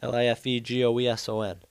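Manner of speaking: L I F E G O E S O N spelled letter by letter, quick. Shown as a demonstration. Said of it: "spells out the phrase".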